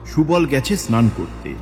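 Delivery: plain reading voice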